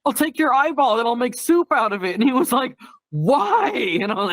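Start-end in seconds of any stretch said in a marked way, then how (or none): 0.1-2.1 high-pitched, upbeat consoling voice; sing-song high pitch, comically encouraging; cheery mock reassurance. Cheering up embarrassed streamer buddy. 3.1-3.7 very high, drawn-out mock bewilderment; imitated high-pitched disbelief; baffled protest. Acts out buddy's baffled reply.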